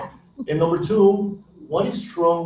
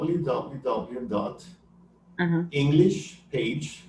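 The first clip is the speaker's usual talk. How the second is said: slow, deliberate dictation; careful slow dictation of a web address; slow spelled-out dictation; much slower than usual. Shown as a demonstration. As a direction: spell it out slowly for the listener. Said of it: "like it sounds, English Page".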